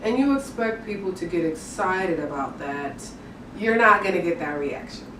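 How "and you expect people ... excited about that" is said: monotone demo. Shown as a demonstration. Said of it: "talking like this the whole time".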